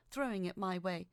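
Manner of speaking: ordinary delivery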